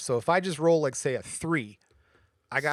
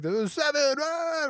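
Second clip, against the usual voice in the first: high, hooting call of the number; excited cheer; slower than usual. Retold or imitated. Gamblers cheering the dice, high excited cry. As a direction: whoop it out like a winning gambler. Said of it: "people are all excited".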